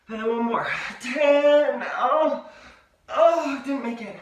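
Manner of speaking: effortful counting mid push-up; straining during push-ups. Real, not acted.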